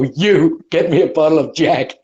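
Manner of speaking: acted shout. Player calling to somebody in the crowd, shouting. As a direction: shout an order across the room. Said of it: "I just wanna shout to somebody".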